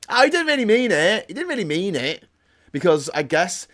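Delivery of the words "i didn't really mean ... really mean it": much higher, louder, breezy brush-off; mock an official waving it away; sarcastic put-on voice excusing it; mocking dismissive voice.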